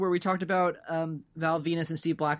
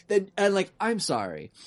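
normal talking voice

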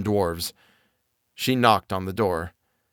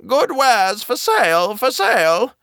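put-on high, slow calling old woman; old peddler calling out; 12 semitones higher, 5 dB louder than usual. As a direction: sing out wares like a hag. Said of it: "called out".

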